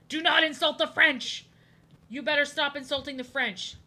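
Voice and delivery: loud, high warning as God; booming mock God. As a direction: thunder the command down. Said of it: "That's what he's saying".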